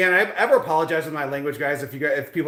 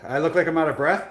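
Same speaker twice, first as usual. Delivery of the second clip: ordinary talk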